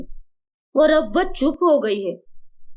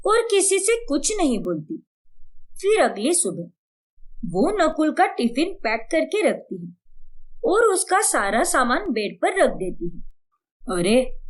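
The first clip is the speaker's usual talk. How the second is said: usual speaking voice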